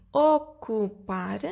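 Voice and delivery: slow, three separate beats; measured counting of each syllable. Shown as a demonstration. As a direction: split the word into its syllables. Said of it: "têm três sílabas".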